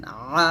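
আ squeezed from mid-throat; squeezed ayn. Shown as a demonstration. Teacher showing the pressure in ayn, squeezed আ. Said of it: "একটা চাপ দিতেছেন".

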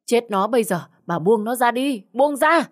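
high command climbing to a yell; urgent shouted order. Acted. Husband stopping wife beating the girl, urgent shout. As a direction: shout to break up a fight.

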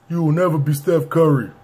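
lightly imitated old-tape rapper. Vlogger mimicking the rap taped in a car, imitated rapper voice.

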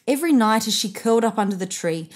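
ordinary delivery

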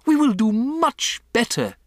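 high, eager-to-appease response; anxious audience reply. A narrator's reading. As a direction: answer as cowed witches promising obedience. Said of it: "murmured the audience".

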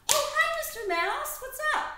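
high, bright greeting to a mouse; very high friendly hello; sweet greeting. Acted. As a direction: sing out hello to a small friend.

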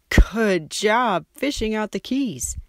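coaxing praise. A narrator's reading. Pete praising the creature in the water, slow and high. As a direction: coax the critter sweetly.

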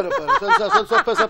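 quick words through laughter; laughing. Real, not acted. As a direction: laugh it out, high and fast. Host laughing while joking.

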